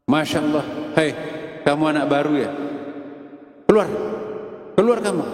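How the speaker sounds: angry kiai ordering the student out; angry teacher. Acted. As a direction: throw the new pupil out in anger. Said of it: "Tiba-tiba gurunya marah".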